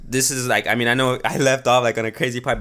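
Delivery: ordinary talk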